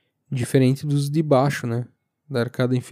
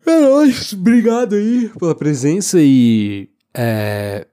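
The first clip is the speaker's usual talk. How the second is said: high, loud, drawn-out farewell; hyped sign-off; 5 semitones higher, 7 dB louder, slower than usual. Real, not acted. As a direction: shout the goodbye with a grin.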